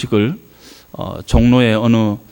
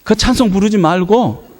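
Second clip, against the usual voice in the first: high-pitched mock scolding; exasperated mother; 8 semitones higher than usual. Mother nagging about the hymn and the chore, high mock exasperation. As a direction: complain over the singing, pitch raised.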